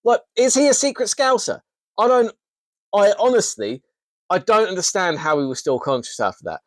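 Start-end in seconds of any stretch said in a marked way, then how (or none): none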